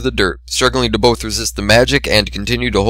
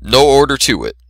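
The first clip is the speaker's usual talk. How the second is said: plain reading voice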